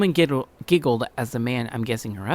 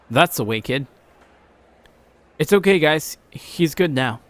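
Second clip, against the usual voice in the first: a hint of a bystander, louder; lightly voiced gruff man; 4 semitones higher, 5 dB louder than usual. A man at the station checking on the fallen boy.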